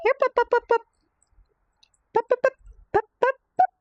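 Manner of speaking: high sing-song pup pup calls; high-pitched puppy call. Real, not acted. Calling puppies in high voice.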